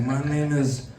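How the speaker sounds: a hint of a mob boss lecturing; lightly voiced godfather Trump teaching politics; mock godfather Trump. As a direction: teach the kid like a mafia don. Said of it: "Don Corleone Trump".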